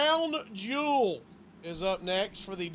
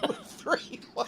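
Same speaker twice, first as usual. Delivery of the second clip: breathy laughing repeat; laughing disbelief; 4 semitones higher, 5 dB quieter, slower than usual. Real, not acted. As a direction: say it through a laugh.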